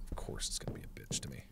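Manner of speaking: quick, whispered grumble; resigned grumble. Real, not acted. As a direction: grumble it fast under your breath. Grumbling at the game.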